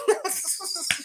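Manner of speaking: high burst of laughter; amused laugh. Real, not acted. Host tickled by the name drop, laughing.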